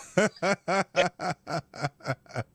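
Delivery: loud burst of real laughter. Real, not acted. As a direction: crack up at the clip. Laughs at the clip.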